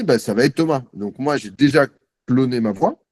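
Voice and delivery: ordinary talk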